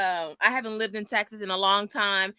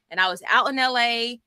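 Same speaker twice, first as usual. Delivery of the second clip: normal talking voice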